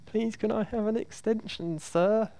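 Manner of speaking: high, soft pleading student voice; grovelling plea. Retold or imitated. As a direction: beg the teacher meekly. Grovelling student asks for extension.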